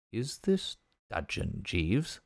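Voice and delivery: soft, slow, probing question; narrow suspicion. Acted. Bertie quietly probing his sulking valet, soft and slow. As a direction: quietly test whether he's sulking. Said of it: "I surveyed him narrowly".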